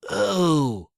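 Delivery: sudden high exclamation. Acted. Coker hit by an idea, sudden exclamation. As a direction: gasp out the idea.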